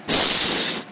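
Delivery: unvoiced snake hiss; short sharp tss hiss. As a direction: hiss through the teeth like a snake. Imitating a hiss at her.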